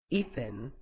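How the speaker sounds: quiet, firm, cutting him off; calm but firm. Acted. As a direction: stop him with one calm word. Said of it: "Victoria cut him off, calm but firm".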